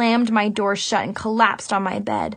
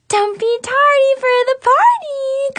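high, loud cheerful shout; party shout; 14 semitones higher, 6 dB louder than usual. A narrator's reading. Chloe bursting in with jazz hands, shouting happily. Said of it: "Chloe shouted".

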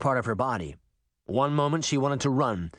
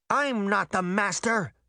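high-pitched protest, volume barely raised; indignant denial, pitched up; mild shout; 7 semitones higher than usual. A narrator's reading. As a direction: cry out in protest without full volume. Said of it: "Pinocchio shouted".

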